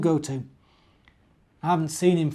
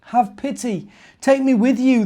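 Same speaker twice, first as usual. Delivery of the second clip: higher, louder desperate call; little bear calling to the passing caravan, pleading; 9 semitones higher, 9 dB louder than usual. Acted. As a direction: plead across the sand to the caravan. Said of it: "the little bear called out".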